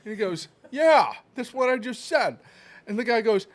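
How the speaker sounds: hammed-up retold banter. Retold exchange, raised voice.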